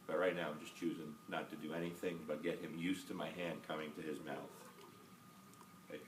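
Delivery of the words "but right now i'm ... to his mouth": ordinary talk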